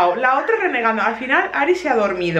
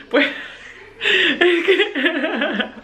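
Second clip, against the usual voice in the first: giggling through the words; can't hold back the laugh; 6 semitones higher, much slower than usual. Real, not acted. Apologising to viewers while laughing. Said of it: "perdón que me ría".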